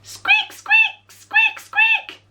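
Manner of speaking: very high, tiny squeaks; mouse squeaks. Shown as a demonstration. Teacher shows the children how a mouse squeaks, very high. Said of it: "A mouse goes squeak".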